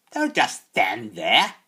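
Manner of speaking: slow, loud, high witch's snort; snorting hag. A narrator's reading. Old hag ordering the boy about, slow loud snort. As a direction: snort it at him like a scornful crone. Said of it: "Wart-Nose snorted".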